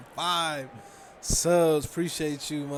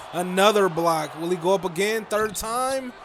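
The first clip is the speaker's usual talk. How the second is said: excited shout; 5 semitones higher, 7 dB louder than usual. Real, not acted. Play-by-play call of blocks.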